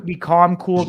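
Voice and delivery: ordinary talk, nothing marked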